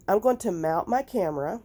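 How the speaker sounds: ordinary talk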